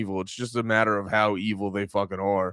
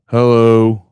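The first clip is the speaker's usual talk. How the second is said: sudden much louder call; loud hello; 13 dB louder than usual. Real, not acted. Streamer calling out when the clip plays no sound, loud. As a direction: call out loudly at the silent clip.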